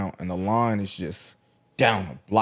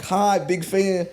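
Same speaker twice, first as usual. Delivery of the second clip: high, drawn-out little greeting; imitated fan greeting; 9 semitones higher, much slower than usual. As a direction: mimic her greeting, high and slow. Imitates the woman greeting him.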